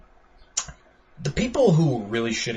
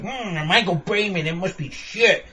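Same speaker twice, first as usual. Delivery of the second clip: mock hater; 5 semitones higher than usual.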